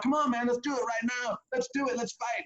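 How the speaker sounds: put-on high, goading drunk voice; mock drunk challenge; drunk best man. Best man challenging dad to fight.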